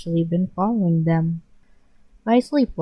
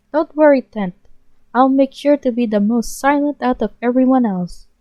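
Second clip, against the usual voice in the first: loud, higher near-yell; 6 semitones higher, 6 dB louder than usual. Acted. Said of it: "practically at the yell".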